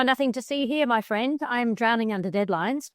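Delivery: ordinary delivery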